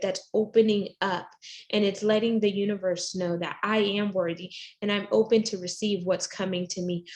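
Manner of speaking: normal talking voice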